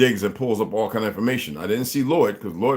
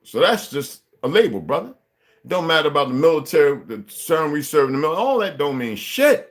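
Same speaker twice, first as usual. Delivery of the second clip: raised, loud, heated tirade; higher and louder street-corner fury; angry rant; 5 semitones higher, 4 dB louder than usual. Real, not acted. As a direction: preach it hard at the crowd, fired up. Heated rant about banks and unity.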